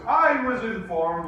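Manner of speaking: mock teacher voice. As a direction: play the teacher making a big declaration. Voicing the teacher's announcement.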